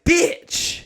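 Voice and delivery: high, loud burst of disbelief; mock outrage. Customer learning the bar is free, mock-outraged cry. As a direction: blurt it sky-high, hammed-up. Retold reaction to free drinks.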